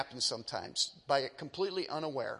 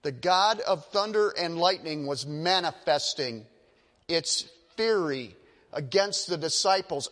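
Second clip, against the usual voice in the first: normal talking voice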